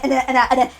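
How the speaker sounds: ordinary talk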